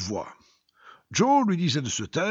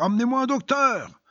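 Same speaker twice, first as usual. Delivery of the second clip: much higher and louder, desperate shout; dying man's cry; 9 semitones higher, 4 dB louder than usual. Acted. Dying man keeps crying out for a doctor, louder desperate shout. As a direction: yell it out, pleading harder. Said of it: "L'agonisant criait toujours".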